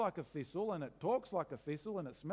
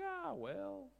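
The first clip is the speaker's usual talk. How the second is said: shrugging indifference; 11 semitones higher, 7 dB quieter, slower than usual. Retold or imitated. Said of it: "and you're like".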